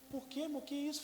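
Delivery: high worried wife voice; the wife asking gently what happened; wife on the phone questioning her husband. Retold or imitated. Wife voiced in retold call.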